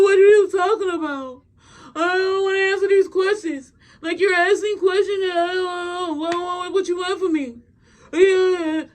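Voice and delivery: high mock wail broken by laughs; laughing and crying. Woman dodging the therapist's questions, mock laughing-crying. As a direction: imitate her blubbering and laughing at once. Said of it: "She's laughing and crying at the same time".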